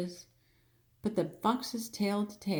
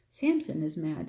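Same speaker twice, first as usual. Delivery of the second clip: ordinary delivery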